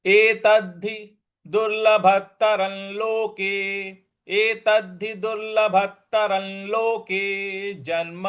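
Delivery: slow, careful Sanskrit recitation; measured verse recitation. Shown as a demonstration. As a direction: recite it slowly, stressing एतद्धि. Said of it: "इस प्रकार से बोलेंगे".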